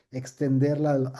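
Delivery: ordinary delivery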